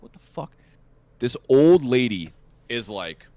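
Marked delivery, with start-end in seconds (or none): 0.0-0.5 near-whispered, baffled reaction; hushed disbelief. Retold or imitated. Storyteller looking over at the old lady, hushed disbelief. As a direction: mouth it almost without voice.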